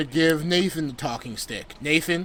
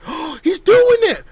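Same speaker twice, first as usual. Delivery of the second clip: excited cheer; 14 semitones higher, 5 dB louder than usual. Retold or imitated. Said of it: "They're like".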